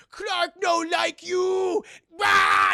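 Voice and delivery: slow, high, shouted brute roar; angry caveman Superman. Acted. To the League, dim Superman roars a furious threat. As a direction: bellow it like a wounded beast. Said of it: "He's like".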